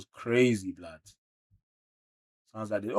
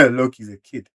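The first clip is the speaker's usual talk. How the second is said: laughing; 2 semitones higher, 10 dB louder than usual. Real, not acted.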